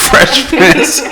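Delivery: host cracking up mid-introduction; laughing while introducing the guest; laughing intro. Real, not acted.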